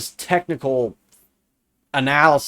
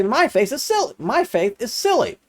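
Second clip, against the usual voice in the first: mock voice, much higher, sing-song; mocking quote; 7 semitones higher than usual. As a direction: parrot them mockingly, pitched up. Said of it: "And then they say".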